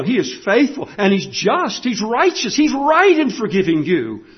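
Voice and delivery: impassioned pulpit delivery; full-force preaching. Real, not acted. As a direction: press the good news home with conviction.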